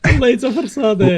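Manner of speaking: loud, laughing through words; laughing while talking. Real, not acted.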